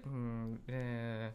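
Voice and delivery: low, soft, unimpressed hum. Retold or imitated. Unmoved by the sisters' talk, a low, soft unimpressed hum. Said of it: "I was like".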